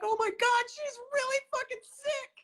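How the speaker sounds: alarmed cry. Retold or imitated. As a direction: cry out in shock at the news. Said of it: "I was like".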